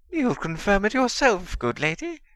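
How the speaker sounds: high, fawning simper; simpering servant. A narrator's reading. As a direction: grovel in a thin high voice. Wormtail addresses Bellatrix.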